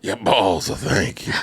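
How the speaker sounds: deep and deliberate from the chest. Shown as a demonstration. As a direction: say it with your chest, drawing the words out. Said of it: "say it again with your chest".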